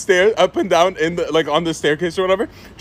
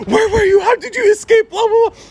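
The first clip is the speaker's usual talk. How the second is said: much higher, louder, rapid questions; mock frantic questioning; 4 dB louder than usual. Relieved mom grabbing her son, mock frantic questions. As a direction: grill him like a panicked mom. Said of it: "she's like".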